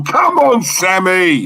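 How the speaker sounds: high, hearty call-out; excited shout-out to a loyal fan; real rallying cheer. Real, not acted. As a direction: cheer on a supporter, pitched well up. Cheering a supporter in chat.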